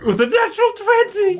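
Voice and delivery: thrilled high yell; excited shout. Real, not acted. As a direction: shout it out in triumph. Rolling a natural twenty.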